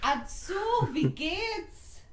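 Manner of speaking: high playful German; German greeting. Acted. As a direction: chirp it in German. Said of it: "You called for a German".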